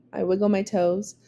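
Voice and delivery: usual speaking voice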